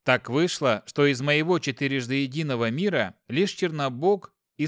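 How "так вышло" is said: ordinary delivery, nothing marked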